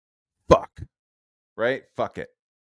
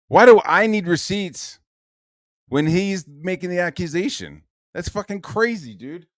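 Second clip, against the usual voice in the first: higher, louder indignant rant; indignant outburst; 6 semitones higher, 5 dB louder than usual. Real, not acted. Streamer fires back at Ethan, indignant and raised. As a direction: push back in heated disbelief. Outraged reply continues.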